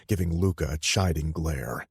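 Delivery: ordinary delivery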